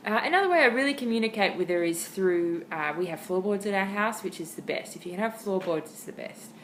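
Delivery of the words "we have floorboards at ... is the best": ordinary talk, nothing marked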